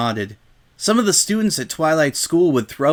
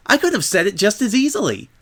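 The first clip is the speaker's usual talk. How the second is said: higher, bouncy pony voice; Pinkie Pie voice; 6 semitones higher, faster than usual. Acted. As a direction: keep Pinkie's chirpy tone going. Pinkie continues her protest.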